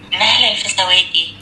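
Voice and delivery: slowly repeats نهلة الفسواتي in a woman's voice; slow repeat of the name. Acted. (يكرر نهلة الفسواتي ببطء بصوت امرأة)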